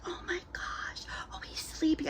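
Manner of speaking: high, breathy mock whisper over a sleeping baby; hushed whisper. Cashier whispering so the doll won't wake, hushed gushing whisper.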